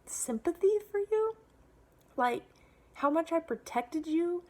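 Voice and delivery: high, incredulous retort to Mom; re-enacted outrage, pitch jumping up; losing it. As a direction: throw it back at her, indignant. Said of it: "I lost it".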